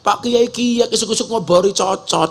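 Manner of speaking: quoting in Ngapak Javanese dialect; Ngapak accent. Retold or imitated. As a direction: imitate a Ngapak Javanese speaker. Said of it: "pakai bahasa ngapak".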